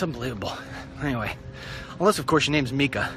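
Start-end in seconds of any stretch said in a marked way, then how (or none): none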